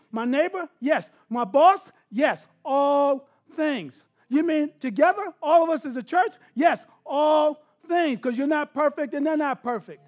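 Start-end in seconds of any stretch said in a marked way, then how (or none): 2.1-4.0 "yes, all things" stretched very slowly; slow emphatic. Real, not acted. Pastor answering congregation, slow emphatic refrain. 6.5-8.2 "yes, all things" stretched very slowly; slow emphatic. Real, not acted. Pastor answering congregation, slow emphatic refrain.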